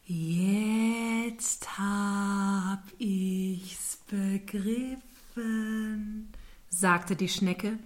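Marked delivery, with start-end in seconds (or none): 0.0-6.3 slow snail voice. Acted. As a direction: drag every word out lazily. Snail voice held.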